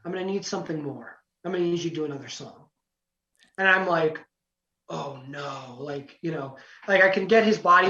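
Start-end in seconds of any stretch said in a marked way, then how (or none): 0.0-2.7 low, soft, deadpan judge impression. Judge asks contestant for another song, low and quiet. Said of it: "I'll do it for a second". 4.9-5.8 low, hushed sinking reaction; quiet dread. Retold or imitated. Contestant to himself after judge wants more, hushed dread.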